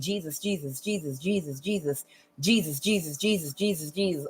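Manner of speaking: soft, hushed repeated Jesus, a mother's quiet prayer; murmured prayer. Retold or imitated. Imitating mother praying on her knees, soft murmur. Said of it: "her prayer was just to keep saying".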